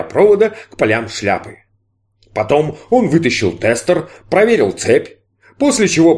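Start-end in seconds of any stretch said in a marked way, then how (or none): none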